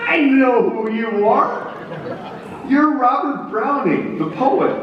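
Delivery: proudly misidentify him; tipsy general greeting Lee; muddled general. Acted.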